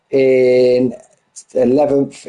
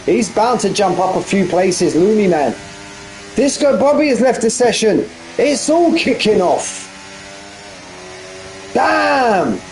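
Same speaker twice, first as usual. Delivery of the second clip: raised, running play-by-play call; live race commentary; 6 semitones higher than usual. Acted. Commentator calling the sim race finish to viewers. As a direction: call the final laps as they unfold.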